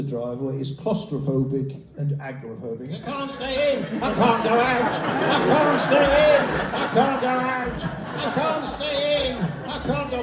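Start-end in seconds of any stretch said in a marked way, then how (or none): 3.0-10.2 high, slow wailing, stuck between in and out; panicky trapped man. Pretend. Trapped neighbour whining that he can't stay in or go out, high and panicky. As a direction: whine it like a man trapped in his own house. Acting agoraphobic claustrophobic neighbour.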